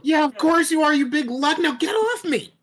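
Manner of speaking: high, loud annoyed voice; squeaky annoyed character. Acted. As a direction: squeak at the big lug. Character scolds the big lug.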